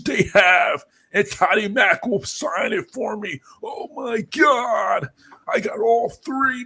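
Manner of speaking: high, slow, hyped-up Californian dude, half shouting; a Spawn fan squealing in a Californian dude accent over a deal. Retold or imitated. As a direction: gush like a stoked Californian bro fan. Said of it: "like, Californian dude accent".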